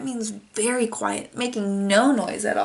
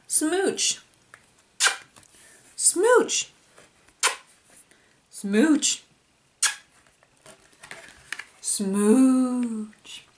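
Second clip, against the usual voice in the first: playful smooch; much slower than usual. Pretend. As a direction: blow silly kisses to the kids. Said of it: "So I'll smooch".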